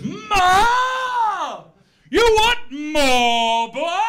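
loud, drawn-out outraged bellow; Mister Bumble. Acted. Workhouse master bellowing at the boy who asked, outraged. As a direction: roar it at the boy, stretching every word. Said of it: "And Mister Bumble goes".

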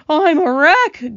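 loud, high, drawn-out wail of complaint; hammed-up groan from the hurt centipede. A narrator's reading. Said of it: "groaned the centipede".

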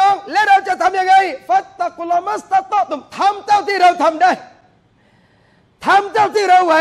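the preacher rouses the congregation, loud and fervent; fiery preaching. Acted. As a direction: preach it to the crowd at full force. Impassioned sermon opening.

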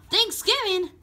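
high, gasping delight; gasp, then pitch the word up excitedly. Acted. Red Yoshi realises tomorrow is Thanksgiving, excited gasp.

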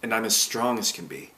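plain reading voice

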